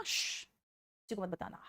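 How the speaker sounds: slow, soft hushed secret with a shh; slow hushed don't-tell-anyone. Shown as a demonstration. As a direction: draw out the shh, then whisper don't tell, slowly. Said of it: "मैंने क्या कहा?".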